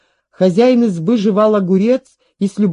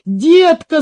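put-on high, loud girl's shriek; ringing cry; 8 semitones higher, 5 dB louder than usual. A narrator's reading. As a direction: cry out in sudden alarm. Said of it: "звонко вскрикнула девочка". (звонкий вскрик)

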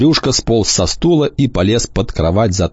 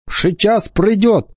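mumbling; 13 semitones higher, slower than usual. A narrator's reading. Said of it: "пробубнила толстая девочка".

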